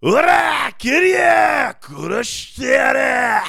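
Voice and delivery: jeune belligérant japonais. Acted. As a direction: burst in as an overacted Japanese thug. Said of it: "jeune belligérant". (jeune voyou japonais, hurlement agressif)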